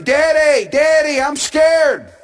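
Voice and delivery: a frightened boy's mock yell; child yelling. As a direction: call out scared to daddy. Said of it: "he yells out from his bedroom".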